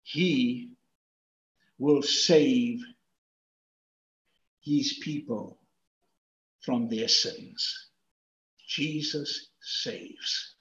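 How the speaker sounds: slow, low, spaced-out phrases; hushed close, fading away; solemn hush. Real, not acted.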